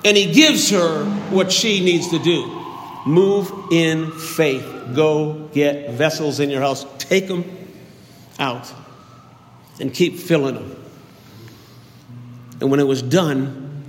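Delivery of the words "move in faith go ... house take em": ordinary delivery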